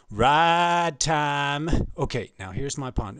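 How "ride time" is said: loud, high, stretched-out holler; drawn-out mock holler; hollered quote. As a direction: belt it out like a big entrance. Acted quote of her entrance.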